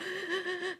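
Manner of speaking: high, quiet gasps, imitating panic; mock panic gasping for breath; quick sharp inhales. Shown as a demonstration. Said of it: "like, sharp inhales".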